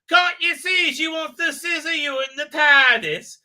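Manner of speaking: high, loud put-on teasing voice; mocking teaser. Dan teasing Yaz about the Doctor, high loud put-on voice. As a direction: needle her in a changed voice.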